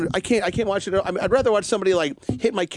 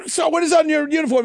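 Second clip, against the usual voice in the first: lightly acted drill sergeant, pitched high; higher-pitched mock sergeant questioning a recruit; imitated drill sergeant; 10 semitones higher, 4 dB louder than usual. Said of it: "he's got these two drill sergeants".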